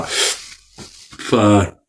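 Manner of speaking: ordinary talk, nothing marked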